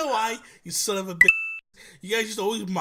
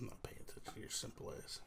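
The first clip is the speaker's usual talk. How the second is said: whispered tease; 21 dB quieter than usual. Real, not acted. Teasing under breath.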